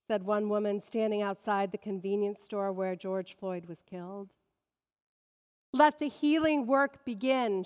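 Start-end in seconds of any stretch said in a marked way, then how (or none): 5.7-7.6 louder, higher quoted cry; mock shout. Woman outside the store shouting to the crowd, mild mock shout. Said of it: "shouted another".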